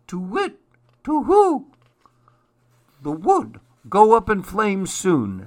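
high, loud owl hoots; hooting owl. A narrator's reading. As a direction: raise the alarm as a hooting owl.

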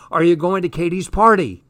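thrilled, rattling off his words again; gushing excited. Acted. Jazz gushing to her friend, repeating Tom's question excitedly.

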